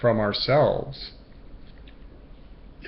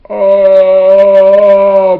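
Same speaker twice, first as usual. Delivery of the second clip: sudden loud high gasped oh; the mind jolts in alarm; 9 semitones higher, 18 dB louder than usual. Retold or imitated. Anxious mind startled at the challenge, loud high oh.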